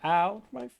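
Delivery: high, loud mock "ow" of pain; mock pained yelp. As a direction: yelp like a hurt finger, hammed up. Complains holding down W key.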